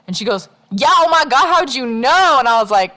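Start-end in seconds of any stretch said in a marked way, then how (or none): 0.7-2.4 high, loud gushing surprise; excited squeal. Retold or imitated. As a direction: squeal it like a starstruck client. Girl excited at Virgo guess.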